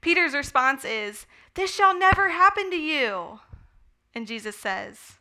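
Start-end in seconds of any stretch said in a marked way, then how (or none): 1.5-3.4 higher, insistent protest in a lightly put-on voice; a touch of alarmed disbelief, not quite shouted; Peter protesting. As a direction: act out Peter's shocked refusal.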